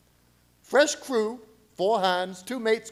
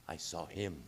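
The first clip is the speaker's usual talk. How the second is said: far quieter, low and breathless; captain alone at the helm confessing horror; hushed dread; 13 semitones lower, 15 dB quieter than usual. Acted. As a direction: barely get the words out. Captain glimpses the creature.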